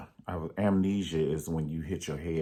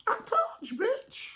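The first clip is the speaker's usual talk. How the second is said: very high squeaky I told you bitch; squealing I told you. Pretend. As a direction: squeak the retort.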